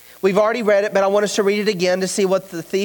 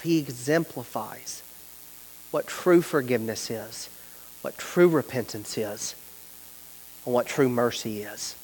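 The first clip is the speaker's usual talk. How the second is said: soft reflective preaching; 8 semitones lower, 6 dB quieter than usual. Acted. Preacher closing the message to the congregation, hushed and intimate. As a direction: lower and softer, the sermon drawn inward. Quiet closing sermon stretch.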